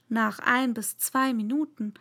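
plain reading voice

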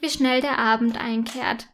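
ordinary delivery

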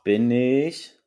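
usual speaking voice

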